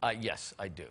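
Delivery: a dry, terse chauffeur reply; imitating the driver. Husband imitating the driver answering his wife. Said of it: "And he goes".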